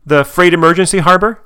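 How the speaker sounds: high, loud mock mom voice; imitated mom. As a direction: put on a high, insistent woman's voice. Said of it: "She's like".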